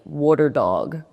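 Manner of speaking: New Jersey accent on water dog. Real, not acted. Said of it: "excuse my Jersey accent".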